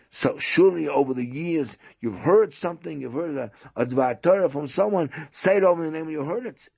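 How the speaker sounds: high, pressing persuasion; insistent host. Retold or imitated. Host presses guest for anything heard, insistent.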